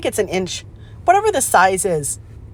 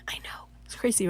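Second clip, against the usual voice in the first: whisper; 13 dB quieter than usual. Real, not acted. Fun factoid aside.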